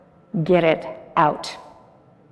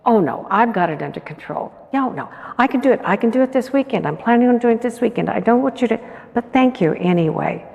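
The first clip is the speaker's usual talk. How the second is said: hurried refusal; 3 semitones higher, faster than usual. Retold or imitated. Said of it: "But no".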